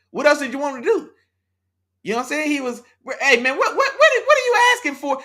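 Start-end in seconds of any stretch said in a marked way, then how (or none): 3.4-5.2 much higher, louder, laughing in disbelief; incredulous high laughing outburst. Real, not acted. Arguing with chat user Isaiah, high laughing disbelief. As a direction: pitch jumps up in laughing protest.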